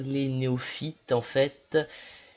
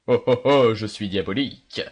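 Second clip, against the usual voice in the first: mock diabolical; 2 semitones lower, 6 dB louder than usual. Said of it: "une personnalité".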